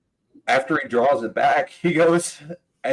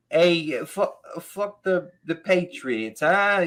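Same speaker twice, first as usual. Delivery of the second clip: higher, slow put-on Boston comic voice; hammed-up Boston accent, higher; lightly imitated Boston accent, higher pitch; mock Boston accent; 6 semitones higher, slower than usual. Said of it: "he says something like".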